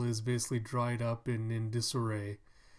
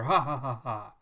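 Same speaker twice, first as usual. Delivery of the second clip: hearty mock laugh; 7 dB louder than usual. Townsfolk laugh about savior.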